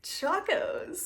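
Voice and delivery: high, rising mock presentation; showing off a catch. Showing sandals to viewers like a caught fish, mock-proud.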